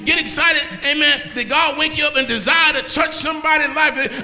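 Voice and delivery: loud, near-sung whooping sermon; full-force preaching. Acted. Preacher whooping to the congregation over music, near-sung. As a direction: chant it with rhythm over the organ.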